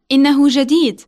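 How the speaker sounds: normal talking voice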